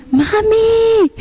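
put-on high, held-out kid call; calling child. Middle son alerting mom in kitchen, calling out. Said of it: "viene Josías, mi nene del medio".

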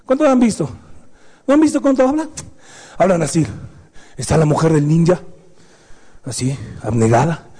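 normal talking voice

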